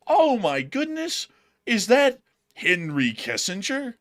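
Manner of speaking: high, loud, drawn-out gasp of recognition; mock astonishment. Host pretends to spot Henry Kissinger, mock astonishment. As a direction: play up the shock, very slowly. Hamming up a surprised sighting.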